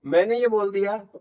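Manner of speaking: usual speaking voice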